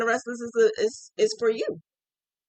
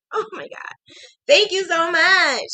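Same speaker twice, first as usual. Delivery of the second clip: ordinary delivery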